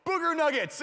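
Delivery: high, loud back-seat yell; prankster's yell. Retold or imitated. Kid in the back seat disrupting mom's text, yelling. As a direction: holler it like a kid sabotaging mom. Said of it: "I would yell".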